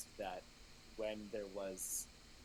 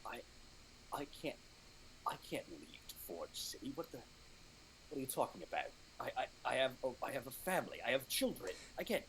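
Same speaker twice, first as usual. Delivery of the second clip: anxious pleading; 5 semitones higher than usual. Acted. Dragonborn panics about family.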